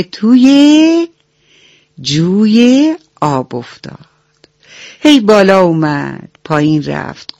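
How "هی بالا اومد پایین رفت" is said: plain reading voice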